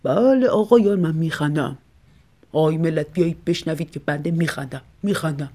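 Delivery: put-on old man muttering sarcastically to himself; talking to himself. A narrator's reading. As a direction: grumble it to yourself in the hallway.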